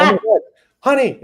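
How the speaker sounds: high, loud gasp of alarm; a burst of startled concern; retold shock. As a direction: react as if the news just landed on you.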